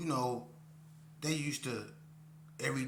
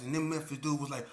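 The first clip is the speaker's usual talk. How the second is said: normal talking voice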